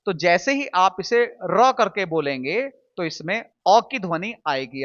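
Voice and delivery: ordinary delivery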